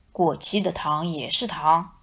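low, gruff wolf; wolf dad shrugging off stale candy. A narrator's reading. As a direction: speak low like a big bad wolf.

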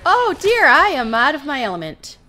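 loud, high-pitched flustered outburst; alarmed excitement. Real, not acted. Player caught outgunned by synths, loud flustered alarm. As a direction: panic gleefully under fire. Ambushed with only pistols.

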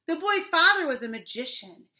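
usual speaking voice

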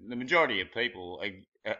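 ordinary talk

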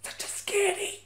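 tiny, very high, hushed squeak; hushed fright. Acted. Ex-con recalling the Dementors, high soft scared voice. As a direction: shrink to a small frightened voice.

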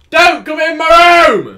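high, loud, drawn-out order; sudden shout. Real, not acted. Player shouting at someone entering the room, sudden shout. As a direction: yell it slowly across the house.

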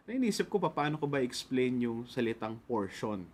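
normal talking voice

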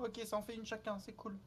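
ordinary talk

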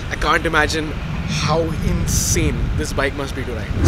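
normal talking voice

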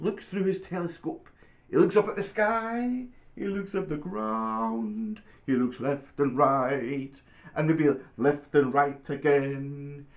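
singsong storybook narration, slowing into suspense; reading to children. Acted. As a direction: start bright and high, then stretch out each phrase. Reads picture book aloud.

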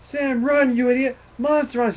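high, loud imitation of a scared bully; panicked yell. Bully warns Sam of a monster, panicked mock yell. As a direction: yell a hammed-up warning to run. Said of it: "He yells up".

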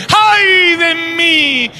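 very slow, high crying lament; anguished wail. Acted. Isaiah before the King, wailing in anguish. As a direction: draw out Ay de mí as a sob. What we say in the glory.

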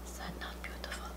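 hushed unvoiced whisper; awed whisper. Real, not acted. Vlogger whispering over the finished sandwich. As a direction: whisper in wonder. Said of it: "it just makes me whisper".

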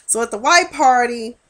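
ordinary talk

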